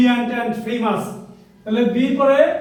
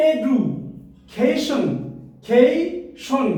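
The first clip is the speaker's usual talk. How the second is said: Education pulled apart slowly, a pause between the parts; slow, segmented education for teaching syllables; broken into syllables; much slower than usual. Shown as a demonstration. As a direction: say education piece by piece, drawing out each chunk. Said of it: "এখন আমরা এটাকে ভেঙে ভেঙে".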